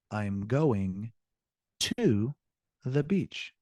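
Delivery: "to" set apart by pauses and held; single out "to" between two clear pauses; stress on "to". Shown as a demonstration. Teacher explaining the answer to "where", heavy stress on "to".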